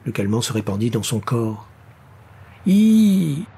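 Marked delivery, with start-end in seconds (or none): 2.7-3.4 loud, high sustained "eee"; high sung wail. A narrator's reading. Age-old voices singing to the drugged man, high wail. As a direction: sing out one piercing long note. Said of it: "chantèrent les voix séculaires".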